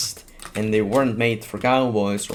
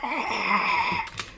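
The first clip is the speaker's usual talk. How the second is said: high squeaky suppressed laugh; squeaky stifled laugh; 12 semitones higher, 3 dB quieter than usual. Real, not acted. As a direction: choke back a squeaky laugh. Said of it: "You sound like a dying rodent".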